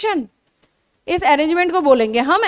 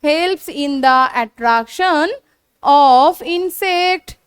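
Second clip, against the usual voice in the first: drawn-out words for students to copy down; slow dictation; much slower than usual. Shown as a demonstration. Teacher dictating a definition to students, slow and drawn out. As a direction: spell it out slowly so they can write.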